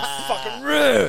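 high, drawn-out mock screech of a car; stretched-out high vehicle noise, acted; angry parking imitation. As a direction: mimic a car yanked angrily to a halt. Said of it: "even parks his car angry".

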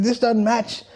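high-pitched mock dad, baffled and scolding; imitating his dad.

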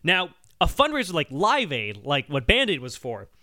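ordinary talk, nothing marked

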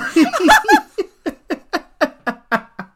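high, loud burst of laughter; helpless laugh at herself; shrieking with laughter; big laugh. Real, not acted. Laughs at own embarrassing story.

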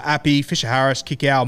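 ordinary delivery

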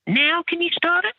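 very high falsetto question; mock duty manager. Duty manager back with her receipt, mock high-pitched demand. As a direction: ask in a put-on squeaky manager voice. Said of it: "came back in the kitchen and said".

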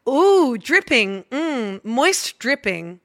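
put-on slow, drawn-out pompous British accent. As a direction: savour each word like a haughty British gent. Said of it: "in a pompous British voice".

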